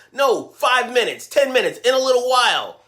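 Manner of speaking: mock a teen brushing off chores; teen stalling when asked to do chores. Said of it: "pushing back on everything".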